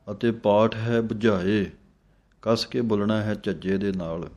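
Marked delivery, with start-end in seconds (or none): none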